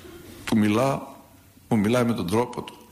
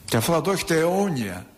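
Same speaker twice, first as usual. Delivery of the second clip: ordinary delivery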